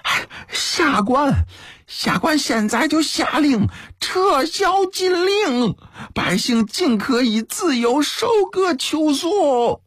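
very high, slow, stretched proclamation; reluctant shouting. A narrator's reading. Cornered official forcing himself to announce surrender, reluctant shouting. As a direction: force out a drawn-out, high cry. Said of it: "硬着头皮喊道".

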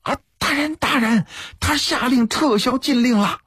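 high, urgent call to someone above. A narrator's reading. Commoner turning to the official on the platform, calling out. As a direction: shout the news up in a high voice. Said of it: "对高台上的刘不周喊道".

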